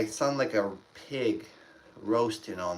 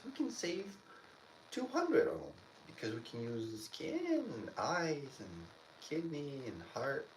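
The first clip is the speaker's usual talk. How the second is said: mock doctor; 3 semitones higher, 11 dB quieter than usual. Imitating callous doctors.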